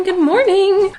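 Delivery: high, loud sing-song "morning"; sing-song greeting. Real, not acted. Repeated greeting, sung out higher.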